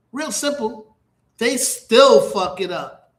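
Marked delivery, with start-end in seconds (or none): none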